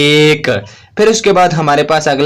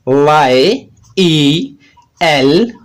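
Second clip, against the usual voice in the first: drawn-out letter names; much slower than usual. Shown as a demonstration. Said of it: "yellow में हमें sound आती है".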